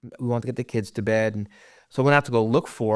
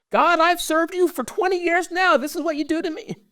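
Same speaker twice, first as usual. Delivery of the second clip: much higher, raw accusing cry; a grieving father's bitter imagined protest, very high; anguished cry at God. Pretend. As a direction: hurl the words up at God in grief.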